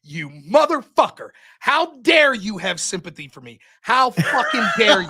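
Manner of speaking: slow, building indignation to a shout; retold outrage. Insulted loser answering the winner's sympathy, acted slow outrage. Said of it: "I was so mad about that".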